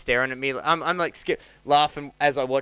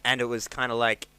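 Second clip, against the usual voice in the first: ordinary talk, nothing marked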